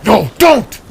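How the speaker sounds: high, sharp cry of alarm; near shout. Acted. Void cries out to stop the sneeze. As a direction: stop him before it happens. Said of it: "Void nearly shouted".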